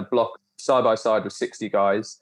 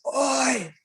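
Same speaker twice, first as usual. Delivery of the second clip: a sudden call pitched far higher; booming oi; 13 semitones higher than usual. Retold or imitated. Instructor calling out a talker in silent ranks, booming. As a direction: bark it across the room. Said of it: "this voice just booms".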